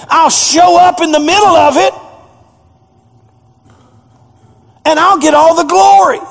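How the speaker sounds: high, loud and rising, God speaking to Paul; preaching at full force. Acted. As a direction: thunder it out from the pulpit.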